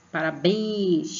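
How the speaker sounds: "Parabéns" called, "béns" held long; calling-out syllable stress. Shown as a demonstration. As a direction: stretch the last syllable of "Parabéns". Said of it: "como se você estivesse chamando alguém".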